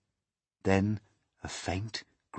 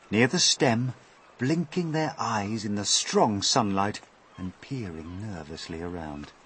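ordinary delivery

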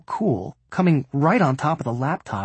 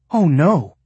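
louder mock-horrified cry; horror-movie fright; 3 semitones higher, 6 dB louder than usual. A narrator's reading. Mom hears where the box is going, mock fright. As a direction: play it like a slasher-film scare. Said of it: "in Freddy versus Jason fright".

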